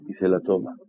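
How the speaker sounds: ordinary delivery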